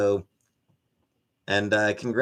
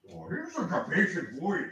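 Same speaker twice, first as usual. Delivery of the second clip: pet talk to cat; 3 semitones higher, 10 dB quieter than usual. Real, not acted. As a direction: coo softly at a patient cat. Praising cat Sam before treats.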